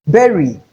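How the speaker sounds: berry for bury, said BEH-ree; rhymes with very. Shown as a demonstration. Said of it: "Berry rhymes with very".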